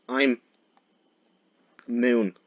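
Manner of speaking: ordinary talk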